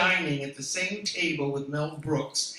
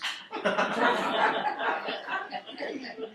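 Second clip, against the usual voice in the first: laugh; 9 semitones higher, 4 dB louder than usual. Real, not acted. Laughs at own joke.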